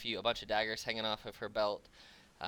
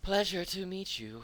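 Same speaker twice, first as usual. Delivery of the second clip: a higher, composed cat boss greeting; boss lady voice; 10 semitones higher, 5 dB louder than usual. Acted. Cat boss lady welcoming the adventurers, composed. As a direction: greet them coolly as the boss. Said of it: "nods and goes".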